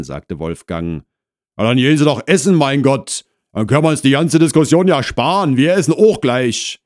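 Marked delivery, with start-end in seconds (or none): 1.6-6.8 loud, quick, raised housewife voice; Frau Krause, exasperated. Acted. Wife sends visitor away before dinner, exasperated.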